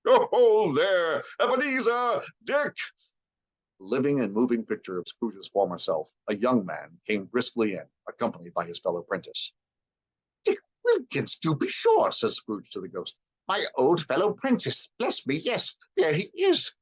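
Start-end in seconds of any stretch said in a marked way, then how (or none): 0.1-2.8 high, loud, rich jovial call; a merry old master calling his boys; jovial fat voice. A narrator's reading. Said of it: "comfortable, oily, rich, fat, jovial voice". 10.4-12.1 much higher, joyful; delighted recognition. A narrator's reading. Scrooge recognizing old friend. 13.5-16.7 high, rising to a squeal; overjoyed old Scrooge; delighted. A narrator's reading. Scrooge excited.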